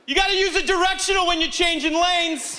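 high-pitched scolding substitute teacher. Acted. Comic to the woman in the next car, substitute-teacher scolding. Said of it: "Like a substitute teacher".

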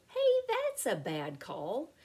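plain reading voice

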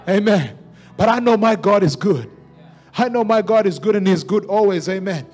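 ordinary delivery

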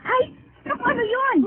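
high, loud alarmed outburst; startled cry. Acted. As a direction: jump at the sudden noise. Mother hears a crash. (gulat na sigaw ng ina)

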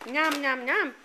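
put-on playful chewing sounds for baby; nom nom chomping. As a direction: chomp it out to coax a bite. Modelling eating for baby.

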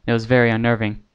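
usual speaking voice

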